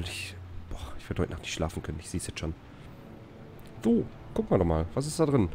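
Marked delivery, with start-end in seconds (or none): 0.0-2.5 breathy whisper, fast. Real, not acted. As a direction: hush it out quickly. Groaning about the humid heat.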